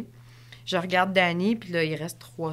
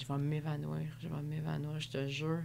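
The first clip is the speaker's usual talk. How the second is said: faint exhausted; 3 semitones lower, 10 dB quieter than usual. Retold or imitated. Exhausted near finish.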